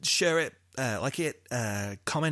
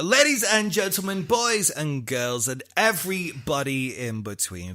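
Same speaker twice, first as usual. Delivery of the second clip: slow, loud, higher showman announcement; announcer style; 4 semitones higher, 7 dB louder, slower than usual. Acted. Host signing off the podcast to listeners, grand announcer. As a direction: roll it out like a ringmaster. Leads the outro.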